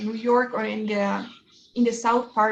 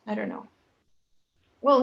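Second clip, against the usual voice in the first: ordinary talk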